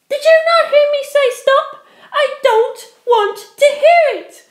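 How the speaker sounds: high, loud furious roar. Acted. Penelope roars at her ex, high and loud. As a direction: roar him into silence. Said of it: "Penelope roared".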